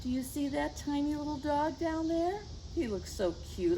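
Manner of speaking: usual speaking voice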